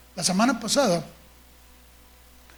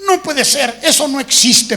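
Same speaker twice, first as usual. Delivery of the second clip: put-on higher, louder kid objecting; a boy who refuses to believe it; indignant little protest; child protesting; 3 semitones higher, 7 dB louder than usual. Child argues in retold story.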